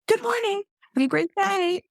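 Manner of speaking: beam it at passersby; cheery greeting. Retold or imitated. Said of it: "sometimes I'm like".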